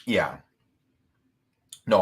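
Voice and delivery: ordinary delivery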